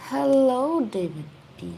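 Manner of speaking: ordinary talk, nothing marked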